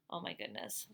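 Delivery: plain reading voice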